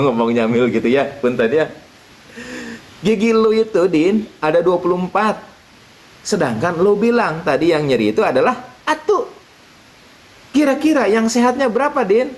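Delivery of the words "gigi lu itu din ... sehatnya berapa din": kiai voice. Acted. Kiai teaching Udin.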